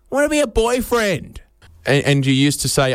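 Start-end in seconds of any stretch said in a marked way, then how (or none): 0.1-1.4 put-on high, eager boy declaring an ambition; young boy's answer. Young boy answering what he wants to be, high kid voice. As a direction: pitch it up like a schoolboy.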